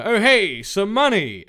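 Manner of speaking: put-on New York accent. Ghost spotting the money in a mild New York accent.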